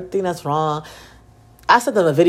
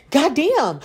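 lightly imitated little kid, pitched up; squeaky toddler voice; 7 semitones higher than usual. Daughter parroting mom's curse, lightly squeaky. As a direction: mimic a small child's squeaky curse. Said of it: "it's like a little squeaky voice".

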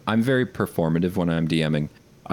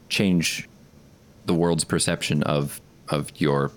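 usual speaking voice

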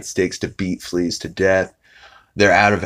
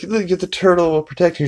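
much higher mock coaxing voice; coaxing mom; 11 semitones higher, faster than usual. Lois coaxing Stewie to swim, much higher sing-song. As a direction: sweet-talk the baby into the water.

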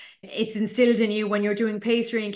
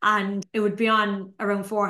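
ordinary talk

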